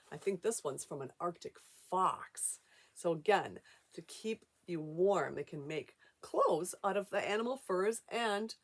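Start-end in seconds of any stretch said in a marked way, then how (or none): none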